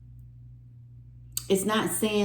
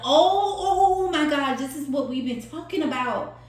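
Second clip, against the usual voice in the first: excited surprise; 8 semitones higher than usual. Real, not acted. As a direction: burst out as it suddenly clicks. Sudden recognition in card text.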